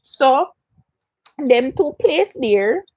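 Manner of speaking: ordinary delivery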